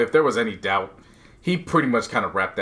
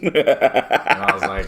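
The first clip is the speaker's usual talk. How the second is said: laughing; slower than usual. Real, not acted. Laughing while retelling.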